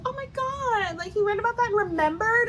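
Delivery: high-pitched, exaggerated awe; mock gushing amazement. She hears that he read about it, mock gushing amazement. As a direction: imitate her swooning, astonished squeal.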